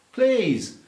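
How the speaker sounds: high-pitched, protesting plea; exaggerated, incredulous plea; mock exasperation. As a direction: beg them to stop, hammed up. Mock rant at American pronunciation.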